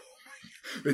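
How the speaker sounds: breathless whisper of dread; whispered panic. Retold or imitated. Teacher muttering to herself in panic, whispered. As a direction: whisper it under your breath in shock. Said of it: "jag får panik".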